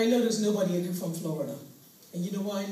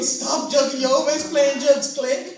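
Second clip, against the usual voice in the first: louder scoffing hang-up; loud dismissive brush-off; 3 semitones higher, 8 dB louder, slower than usual. Retold or imitated. Family dismissing the kidnapper's call, louder. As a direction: brush the caller off loudly.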